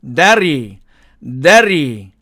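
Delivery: "Daddy" slowly drawn, falling; exact pronunciation. Shown as a demonstration. Teacher models the word for learners, careful exact pronunciation. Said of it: "정확하게 제가 발음해 볼게요".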